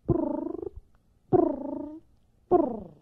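purring. Acted. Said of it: "it began to purr".

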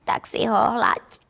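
low, slow mocking tease; spiteful snicker. Big cat Týnka teases the hungry kitten, mild spiteful snicker. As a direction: sneer the pun with a lightly voiced malicious giggle. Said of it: "zahyhňá se zlomyslně Týnka".

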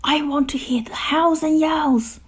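high, hushed pleading; begging. A narrator's reading. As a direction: beg in a high stage whisper. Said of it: "begged Grump".

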